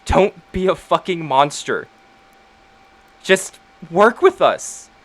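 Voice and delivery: forceful plea. Acted. Penelope urging Iker to let them help, forceful. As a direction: drive every word home.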